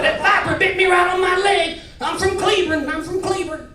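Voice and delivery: high, hollered cowboy boast; cowboy twang. Retold or imitated. Cowboy boasting about Cleburne, high cowboy twang.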